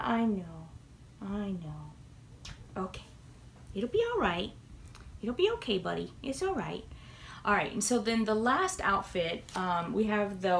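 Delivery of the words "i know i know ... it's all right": soothing a pet. Real, not acted. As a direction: reassure the scared cat softly. Comforting nervous cat.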